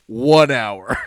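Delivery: loud, confident declaration; pristine confidence. Retold or imitated. Boy answering his teacher with total confidence. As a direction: announce it like it's obviously right. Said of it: "with this pristine confidence".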